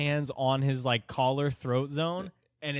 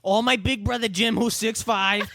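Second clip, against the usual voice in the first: much higher, louder outraged parody, laughing; mock ranting short man; 10 semitones higher, 7 dB louder than usual. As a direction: yell the indignant question like the tiny furious man.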